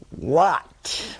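swooping, exasperated "What?"; mock-gentle reply. Interrupted nun answering from the machine, mock gently. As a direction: pretend gentleness with the irritation showing. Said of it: "I very gently said".

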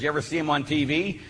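normal talking voice

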